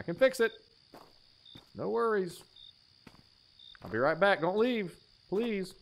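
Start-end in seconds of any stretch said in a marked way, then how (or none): none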